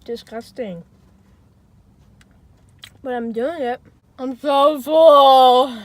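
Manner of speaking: talking through a mouthful; mouth full. Real, not acted. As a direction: speak around food. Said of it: "Talking with your mouth full".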